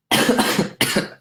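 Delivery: a rough chesty cough from a cold; harsh cough. Real, not acted. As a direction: cough hard, mid-sentence. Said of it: "and that nice cough".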